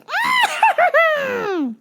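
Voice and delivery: long high shrieking cackle; manic cackle. Retold or imitated. She comes in the store, manic high cackle. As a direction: mimic a crazed, shrieking laugh. Imitates woman having found a sucker.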